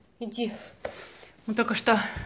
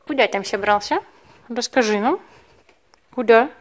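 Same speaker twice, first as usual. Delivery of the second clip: teasing scolding; 7 dB louder than usual. Real, not acted. Scolding runaway cat playfully.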